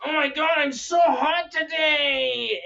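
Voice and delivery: high, drawn-out whiny cartoon girl; super whine, all cracky and cringy; Lola. Cartoon girl talking to one of the guys, super whine. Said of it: "Lola's voice".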